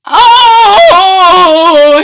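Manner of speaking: high, loud startled oh; gasped oh. Acted. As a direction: recoil from the bad breath. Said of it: "he gasped".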